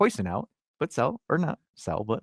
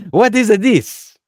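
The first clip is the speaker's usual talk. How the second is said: put-on loud, high, drawn-out Italian accent; hammed-up Italian-accented outburst; 10 semitones higher, 12 dB louder, much slower than usual. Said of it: "throw in the Italian accent".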